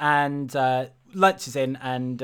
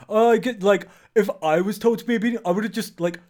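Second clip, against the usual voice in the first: loud, higher, smug-bro parody; mock Twitter bro; 5 semitones higher, 5 dB louder than usual. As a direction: brag like an online know-it-all. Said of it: "like, shitty-ass Twitter bros".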